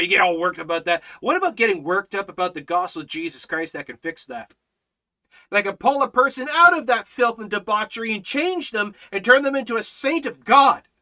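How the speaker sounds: rising loud pulpit build; impassioned preacher's crescendo; fiery preaching. Acted. Preaching at full force.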